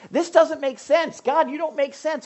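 high, loud, slowed complaint to God; mock frustrated doubter. Preacher voicing a believer who complains to God, mock frustrated. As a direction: whine it like a confused believer.

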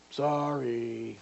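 put-on low, drawn-out grudging kid's apology. Kid forced by a parent to say sorry, grudging. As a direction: mumble a sorry you don't mean.

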